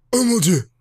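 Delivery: usual speaking voice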